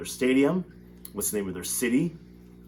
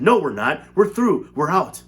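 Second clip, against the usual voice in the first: triumphant cry; 5 semitones higher, 7 dB louder than usual. A narrator's reading. Wonka triumphant over the crash.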